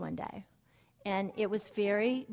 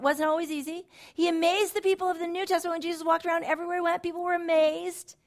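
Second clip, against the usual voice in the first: higher, louder, impassioned sermon voice; fervent preaching; 9 semitones higher, 6 dB louder than usual. Acted. Speaker telling the congregation God wants to amaze them, fervent preaching. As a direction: preach it out with joy and conviction.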